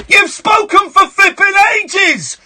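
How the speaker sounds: high, slow, emphatic yelling; indignant outburst at full pitch; exasperated shout. Real, not acted. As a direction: shout down the caller's complaint. Host rebuts caller's complaint.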